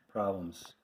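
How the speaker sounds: ordinary talk, nothing marked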